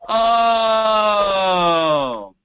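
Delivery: high, loud moan sliding down. Shown as a demonstration. Voice teacher showing vocal closure, loud falling moan. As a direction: let out a long primal moan. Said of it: "Listen to the closure of that moan".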